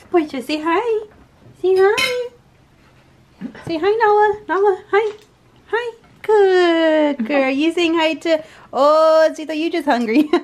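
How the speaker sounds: high, loud pet talk. Real, not acted. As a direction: coo at the dog in a high voice. Talking to pet dog.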